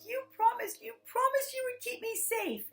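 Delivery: very high, loud little-kid wail, acted; freaking-out child. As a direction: imitate a panicked five-year-old accusing a parent. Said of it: "She's freaking out".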